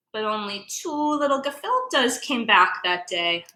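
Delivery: ordinary delivery, nothing marked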